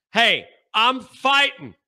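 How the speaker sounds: ordinary talk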